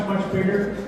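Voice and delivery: ordinary delivery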